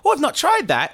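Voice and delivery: high sing-song voice of a barmaid trying your drink; mock barmaid.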